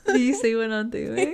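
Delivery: laughing through the words. Real, not acted. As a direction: speak while cracking up. Laughing hard.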